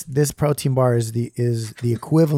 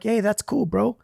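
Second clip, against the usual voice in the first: mock casual bro voice; 8 semitones higher than usual. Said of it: "people are like".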